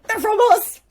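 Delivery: very high, loud mock squeal; excited shout. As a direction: burst out like thrilled fans confessing. Couple revealing they sent drinks.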